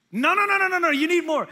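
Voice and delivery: high, loud barrage of refusals; insistent arguing. Retold or imitated. Hosts arguing with a guest who says no, insistent. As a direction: overrule them with loud, high-pitched insistence. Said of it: "They'll argue with you".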